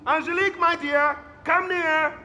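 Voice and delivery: high, coaxing summons; fond calling. Acted. Father calling daughter Angelique closer, fond summons.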